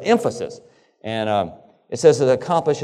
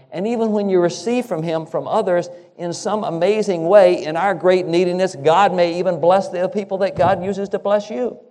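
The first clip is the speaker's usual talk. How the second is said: higher, building preacher's fervor; rising preaching; 7 semitones higher, 3 dB louder than usual. Acted. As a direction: lift the sermon toward its peak. Preacher builds to a high point.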